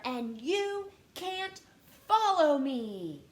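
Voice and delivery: loud, slow upset protest; upset George. Acted. George telling his little brothers they can't follow. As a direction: push the brothers away, upset. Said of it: "George sounds upset".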